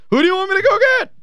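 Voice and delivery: high, fast, put-on voice mimicking the owner's question; mock the owner asking, quick and high; imitating the owner. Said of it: "Michael Heisley better never ask me again".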